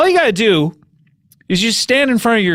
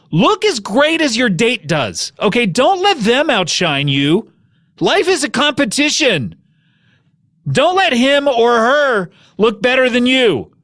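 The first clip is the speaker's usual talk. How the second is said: emphatic, drawn-out sales pitch; slow down and push each line like a command; urgent mock-motivational pitch; hammed-up ad read; 7 semitones higher, slower than usual. Real, not acted. Podcast host reads an ad.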